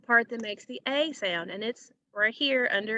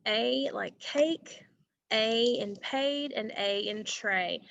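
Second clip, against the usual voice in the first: slow example words; slower than usual. Shown as a demonstration. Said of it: "supposed to be like a short A sound".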